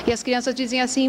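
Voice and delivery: usual speaking voice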